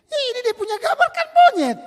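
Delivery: put-on very high, loud, laughing kid's voice; laughing child.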